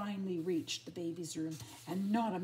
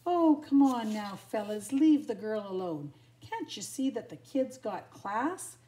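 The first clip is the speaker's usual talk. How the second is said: loud, sassy scolding; brassy, protective telling-off; 2 semitones higher, 9 dB louder than usual. A narrator's reading. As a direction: tell the fellas off with sass. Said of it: "said a sassy-looking female".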